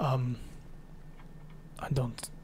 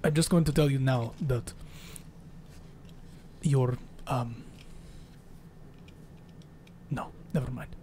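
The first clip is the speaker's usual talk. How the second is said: put-on Russian accent; 3 semitones lower, 8 dB quieter than usual. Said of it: "Russian is not hard to do".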